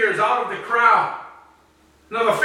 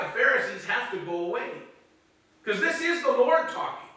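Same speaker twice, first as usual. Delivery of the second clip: ordinary delivery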